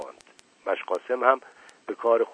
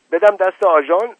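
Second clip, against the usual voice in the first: much higher, louder indignant cry; indignant outburst; 10 semitones higher, 12 dB louder than usual. A narrator's reading. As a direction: explode at the very idea. Uncle rejects handing thief over.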